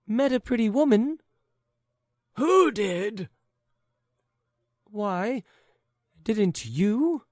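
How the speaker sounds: high, quick back-and-forth; lightly voiced confused old pair; bickering old couple. Old husband and wife muddling who met whom, lightly voiced.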